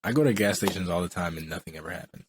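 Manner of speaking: ordinary talk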